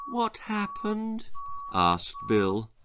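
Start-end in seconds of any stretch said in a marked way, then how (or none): 0.1-1.2 put-on high, soft, slow little boy's voice; a confused child just waking up; a small boy asks, bewildered, soft and high; dazed boy. Boy wakes in hospital.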